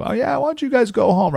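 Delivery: sugary pretend kindness toward the enemy; a king faking goodwill to trapped soldiers, mock sweet; fake nice. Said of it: "He pretended to be nice".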